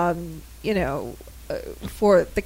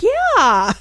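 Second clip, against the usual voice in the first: excited yeah; 7 semitones higher, 7 dB louder than usual. Retold or imitated. Imitating her own excited reaction.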